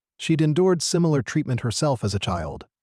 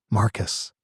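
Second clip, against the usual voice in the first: plain reading voice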